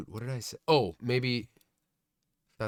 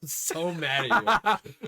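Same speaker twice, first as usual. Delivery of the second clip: mock angry laughing; 6 semitones higher, much slower than usual. Teasing after interruption.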